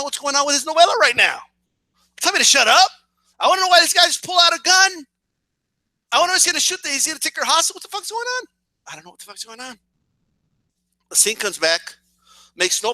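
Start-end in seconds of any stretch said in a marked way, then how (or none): 3.4-8.5 high-pitched frantic demands; desperate to know what happens next; frustrated high outburst. Retold or imitated. Viewer shouting at the novela, high and frantic. 8.9-9.8 much softer, resigned admission; deflated near-whisper. Retold or imitated. Hooked viewer trailing off, soft and resigned.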